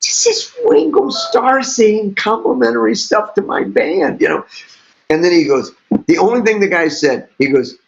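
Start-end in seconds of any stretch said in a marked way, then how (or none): 0.0-4.2 high, awed inner voice; awed inner thought. Retold or imitated. Thinking about [name]'s praise, awed and higher. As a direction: sound starstruck in your head. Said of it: "and I'm thinking".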